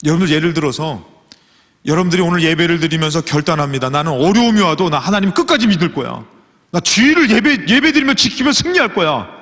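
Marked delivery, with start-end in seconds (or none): 3.9-9.3 rising higher into a loud, determined vow; fervent vow. Acted. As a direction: swear it with growing fire. Acted worshipper's vow. (점점 높고 커지는 결단의 선언)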